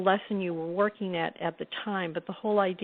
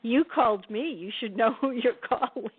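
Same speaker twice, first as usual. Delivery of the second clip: higher, amused mock retort; imitating the mentor; 4 semitones higher than usual. Mentor answering a startled caller on the phone, mock chiding with amusement. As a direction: lightly play her chiding comeback, laughter near the surface. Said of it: "And she said to me".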